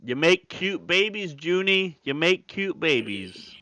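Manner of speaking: high, slow, loud gushing praise. Real, not acted. Admirer praising a parent's new baby, gushing. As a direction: draw it out, cooing with delight.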